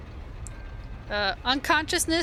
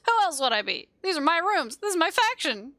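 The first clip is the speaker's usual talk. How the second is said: higher, irritated retort; snapping; 6 semitones higher than usual. Acted. Man locked in his rooms answers the intruder, snapping. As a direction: snap back sharply with raised pitch. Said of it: "The voice snaps".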